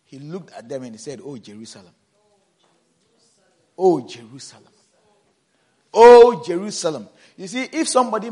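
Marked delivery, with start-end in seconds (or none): 1.2-1.9 Oh, Jerusalem said low and soft, falling at the end; a quiet, lowered first cry of Oh, Jerusalem; soft lament. Shown as a demonstration. Jesus voiced by the preacher, lamenting softly. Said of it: "a word twice, it is for emphasis". 3.8-7.1 Oh, Jerusalem again, Oh punched much louder; emphatic repeat. Shown as a demonstration. Preacher repeating Jesus' lament for emphasis, loud. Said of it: "a word twice, it is for emphasis".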